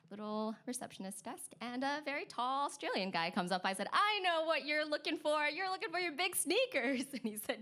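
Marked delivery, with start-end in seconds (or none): 3.9-7.0 high, gleeful recognition; eager greeting. Retold or imitated. Receptionist greets tall sneaker owner, high eager excitement. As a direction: pipe up high, sure you know why they came.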